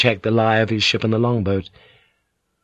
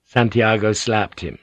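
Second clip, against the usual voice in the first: plain reading voice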